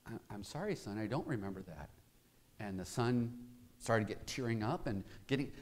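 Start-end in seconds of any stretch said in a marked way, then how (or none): 0.1-1.9 soft, hesitant dad; a hint of a reluctant father; quiet father. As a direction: let the father answer low and soft. Father gently refusing.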